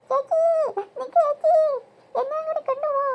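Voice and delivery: high-pitched tearful calling; hammed-up childish wail; wailing plea. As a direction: cry out after a departing sister. Little sister wailing after sister.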